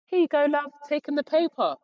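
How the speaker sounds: high, loud, sing-song desk lady. Retold or imitated. Desk lady handing over the test result, high and loud. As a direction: voice a chirpy high-pitched receptionist.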